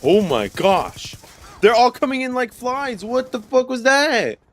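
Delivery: high, alarmed shouting; startled high outcry. Real, not acted. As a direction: yelp in sudden shock. Sudden swarm of enemies in game.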